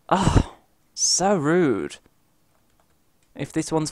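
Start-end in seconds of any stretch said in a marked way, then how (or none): 0.1-2.0 slow, stretched oh so rude; drawn-out offended. Real, not acted. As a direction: drag it out in mock offence. Mock offended drawl.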